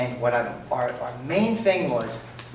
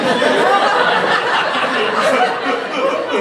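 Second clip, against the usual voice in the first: long loud high laugh; big laugh; 6 dB louder than usual. Real, not acted. Cracking up at own story.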